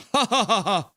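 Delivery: high bursting laugh; real laugh. Real, not acted. Reacting to chat, real laughter. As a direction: laugh out at the joke.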